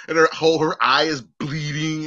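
ordinary talk

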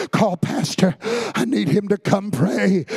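dying father crying out for his pastor; pleading cry. Retold or imitated. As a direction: beg loudly for the pastor. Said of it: "your daddy screaming".

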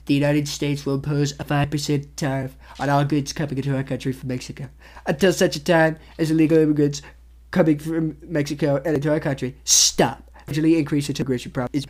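reading a tweet as Donald Trump. Retold or imitated. Vlogger reading Trump's tariff tweet, in a Trump impression. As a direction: do a Trump impression, steady through. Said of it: "I'm gonna read it in his voice".

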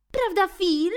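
high loud sneering cat asking his pal; bully cat. Acted. Bill asks Phil to agree, high sneering cat. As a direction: nudge your buddy for backup. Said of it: "zagroził Bill".

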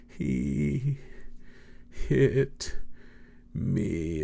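soft, whimpering little "He hit me"; tearful child's complaint. Retold or imitated. Retelling sister's tearful tattle to Dad, mock whimper. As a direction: mimic the crying child tattling, quiet and wounded. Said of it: "between tears".

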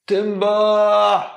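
bellowed, high and loud, for the whole street; loud shout. Acted. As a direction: yell it so the neighbours hear. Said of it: "he shouts really loud".

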